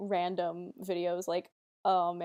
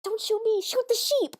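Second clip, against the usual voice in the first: put-on much higher little-kid voice; imitating a six-year-old, much higher; 13 semitones higher than usual. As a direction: urgently direct the camera like a small child. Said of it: "the six-year-old me is like".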